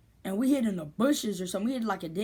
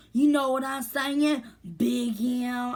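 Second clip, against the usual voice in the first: high, loud, stretched-out mimicry; mock a spaced-out addict, dragging the words; imitated druggie; 5 semitones higher, 5 dB louder, much slower than usual. Imitates girl supposedly on drugs.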